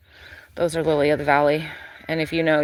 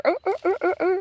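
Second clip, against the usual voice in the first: clucking at a hen; 13 semitones higher, much faster than usual. Real, not acted. Clucking at the bird.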